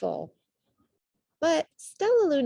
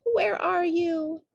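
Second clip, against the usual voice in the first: squeaky call; 10 semitones higher, 4 dB louder, slower than usual. A narrator's reading. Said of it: "Stellaluna squeaked".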